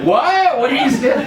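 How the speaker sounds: high, loud disbelief; incredulous mock outcry. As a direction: blurt it out, comically appalled. Mugger's shocked reply, retold.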